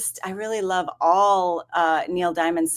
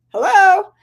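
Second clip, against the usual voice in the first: loud, high, exasperated hello?; pointed hello; 12 semitones higher, 9 dB louder than usual. Retold or imitated. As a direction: call out sharply for attention. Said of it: "And I'm like".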